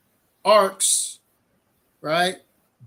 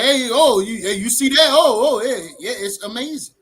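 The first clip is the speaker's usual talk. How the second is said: excited rainbow gawking; 6 semitones higher, 6 dB louder than usual. Retold or imitated. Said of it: "everybody be like".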